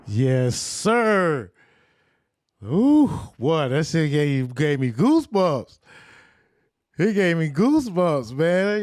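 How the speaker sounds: loud, high, fired-up praise; real goosebumps excitement; hyped reaction. Real, not acted. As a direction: shout your excitement after the clip. Hyped reaction after the clip.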